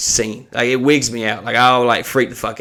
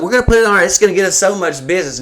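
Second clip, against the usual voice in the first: mock excited; 7 semitones higher, much faster than usual. Imitating manager's excitement.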